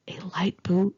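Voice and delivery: embarrassed whispered aside; ashamed whisper. Acted. Admitting her childish day planner, sheepish whisper. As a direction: whisper it like a guilty confession. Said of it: "hanging my head in shame".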